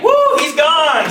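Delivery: high, loud joking whoop; mock cheer. Comedian pretending the audience cheers he's leaving, loud high whoop. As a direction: whoop it like the crowd celebrating.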